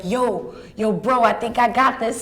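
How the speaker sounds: in character, a hint of a Queens accent. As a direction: talk like a cousin from Queens. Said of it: "he has that accent".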